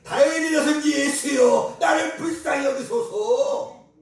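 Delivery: loud, high, slow desperate cry; shouted plea. Retold or imitated. Preacher voicing the blind man crying out, shouting. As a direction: cry out for mercy at full voice. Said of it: "그때부터 소리 지르는 거예요".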